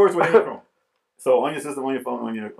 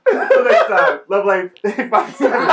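high, laughing sign-off; 3 semitones higher, 4 dB louder, slower than usual. Real, not acted. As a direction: giggle through the goodbye. Laughing sign-off after the bit.

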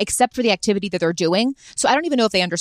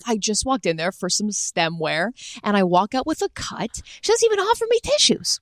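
Larry David-style recounting. Retold or imitated. Imagining Larry David explaining the glass mishap back, mock indignant. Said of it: "he's explaining it back".

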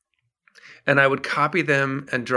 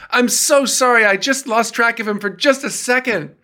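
apologetic minder; 9 semitones higher than usual. Retold or imitated. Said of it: "turning his head back towards us saying".

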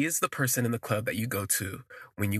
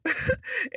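high burst of laughter. Real, not acted. Laughing at Amir's question.

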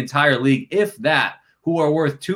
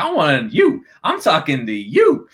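higher, hard stress on each "you"; mock confrontation; 5 semitones higher, slower than usual. Player singling out the reporter, mock confrontation. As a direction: point the imitated challenge at one person.

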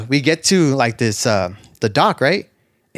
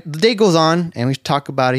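usual speaking voice

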